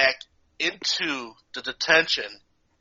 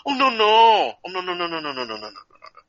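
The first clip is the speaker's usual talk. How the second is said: sing-song no no no, trailing off fast; teasing teacher rattling off no; mock refusal; 4 semitones higher, 4 dB louder, faster than usual. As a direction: wag the finger with a string of nos.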